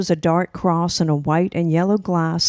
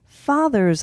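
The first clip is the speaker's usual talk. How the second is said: put-on a child's eager high exclaim; 7 semitones higher than usual. Child to mother in car, eager exclaim. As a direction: blurt it out, higher and eager. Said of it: "I exclaimed".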